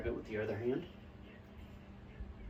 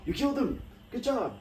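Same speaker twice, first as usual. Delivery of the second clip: higher, louder cheer; excited cheering; 9 semitones higher, 4 dB louder than usual. Real, not acted. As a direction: cheer them on. Cheering a VR kill.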